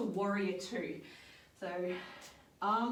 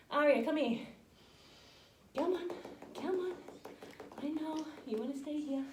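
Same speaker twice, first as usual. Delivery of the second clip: coaxing the dog; 6 semitones higher than usual. Real, not acted.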